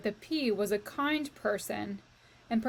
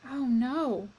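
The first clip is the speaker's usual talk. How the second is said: ordinary delivery, nothing marked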